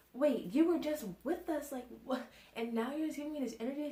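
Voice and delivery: mock bewilderment, voice up; baffled protest. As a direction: question it, thrown by the sudden change. Retold baffled reply to mom.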